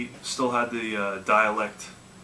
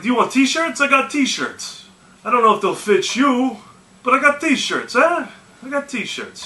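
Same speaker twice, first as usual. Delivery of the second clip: much higher, louder hammed-up old Italian woman; mock old Italian lady; 13 semitones higher, 8 dB louder than usual. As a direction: play the pushy old Italian seller offering t-shirts. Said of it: "the accent going".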